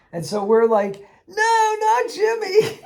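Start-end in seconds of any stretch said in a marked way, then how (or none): 1.3-2.8 high, slow hammed-up wail of grief; mock anguish. Players mourning their cooked spider pal, hammed-up wail. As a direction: overplay the grief for a dead spider.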